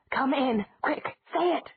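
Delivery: high, hurried urging; urgent pleading. A narrator's reading. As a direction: press her to say it. Urging child to invite her.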